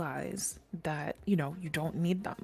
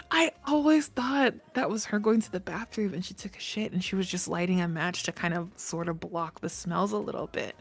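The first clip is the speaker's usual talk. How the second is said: ordinary talk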